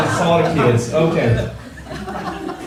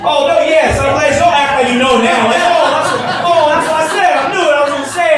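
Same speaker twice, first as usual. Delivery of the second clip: excited mock backpedal; 8 semitones higher, faster than usual. Real, not acted. Mock retracting a guess.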